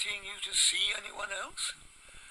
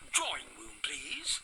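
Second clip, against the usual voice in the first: low, hushed Drawing room, please; posh etiquette lesson; refined correction; 8 semitones lower than usual. Shown as a demonstration. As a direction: correct her gently but pointedly. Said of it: "you said front room".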